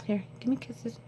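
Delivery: soft pet talk. Real, not acted. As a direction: coo at the cat. Cooing to cat.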